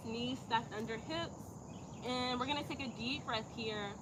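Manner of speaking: soft, measured yoga teacher voice; yoga instruction. Real, not acted. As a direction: guide the class quietly through posture and breath. Leads cat cow yoga flow.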